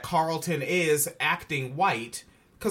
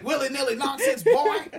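hammed-up southern preacher, much higher and louder; mock southern ranter; 8 semitones higher, 5 dB louder than usual. Host mocks Carlton as a southern firebrand, hammed-up southern yell. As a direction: shout it like a southern firebrand, laughing. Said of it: "say it like Alex Jones".

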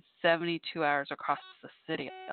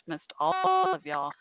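sincere, drawn out; 13 semitones higher, 3 dB louder, much slower than usual. Real, not acted. Telling listeners she missed them.

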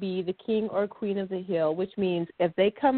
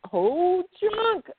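playful; 10 semitones higher than usual. Acted. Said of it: "we have too much fun just saying it".

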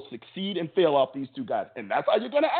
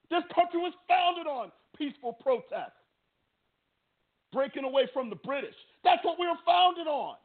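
heated rant pitched much higher; a fan's much higher, heated scolding of his team; real anger; 14 semitones higher than usual. Real, not acted. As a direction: let the anger push the voice up as you scold the players. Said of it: "That's how angry I am about it".

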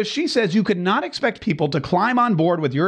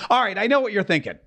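ordinary talk

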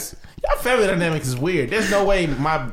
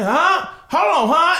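high, loud questioning "huh?"; mock hard of hearing; 11 semitones higher, 5 dB louder, slower than usual. Granddad stonewalling a telemarketer on the phone, loudly playing deaf. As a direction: pretend you can't hear the caller. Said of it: "He'll get louder".

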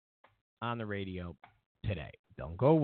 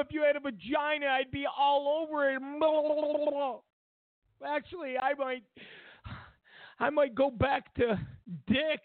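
higher, hammed-up swooning fan voice, with shouts; mocking a TV host swooning over the president, much higher; Rachel Maddow mockery; 9 semitones higher than usual. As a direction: gush over him like a starstruck groupie. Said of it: "Rachel Maddow, she".

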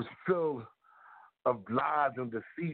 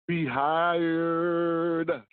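Rehired belted louder, drawn out; close-mic shout; 4 semitones higher, 5 dB louder than usual. Shown as a demonstration. Telling Doctor Fauci he is rehired, leaning into the mic loudly. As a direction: lean into the mic and belt Rehired. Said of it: "I had to get up closer to the mic to say that one".

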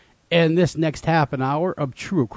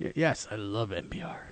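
whisper; 6 semitones lower, 12 dB quieter than usual. Pretend. Mock whisper after NPR joke.

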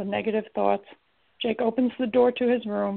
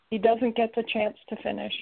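usual speaking voice

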